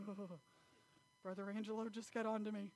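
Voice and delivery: imitating a scolded kid, softly; quiet mock-worried muttering; hushed dread. Child dreading a whipping in church.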